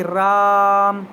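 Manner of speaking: राम with the आ drawn out very long and slow, like calling from afar; stretched call of a name. Shown as a demonstration. Teacher demonstrates the plut vowel to students, calling राम with a drawn-out आ. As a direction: call राम, holding its long vowel well past normal. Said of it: "किसी को पुकारते हैं हम जब".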